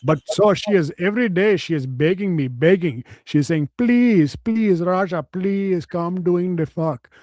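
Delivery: mock Indian accent, slow in places; man boasting of his fantasy in a mock Indian accent.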